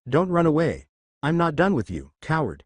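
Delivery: plain reading voice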